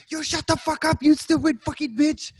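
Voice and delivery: high-pitched hammed-up rage; mock scream without much volume. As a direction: imitate a scammer losing his mind, high and strained. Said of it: "just screaming".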